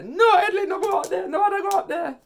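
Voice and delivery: very high, loud pleading yell; mother's frantic shout. Retold or imitated. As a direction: shriek it to hold him back. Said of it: "Me just hear my mother say".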